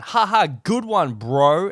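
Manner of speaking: a dry, fake-amused brush-off; sarcastic fake laugh. The wife brushing off the joker with a slow, high sarcastic laugh. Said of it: "[name] just laughed in his face".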